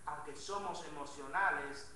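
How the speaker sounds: ordinary talk, nothing marked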